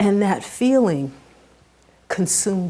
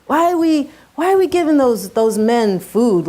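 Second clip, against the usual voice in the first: put-on a child's whiny, higher complaint; childish whine; 5 semitones higher, 5 dB louder than usual. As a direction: whine it like a disgusted nine-year-old, a bit louder. Child complaining about the men.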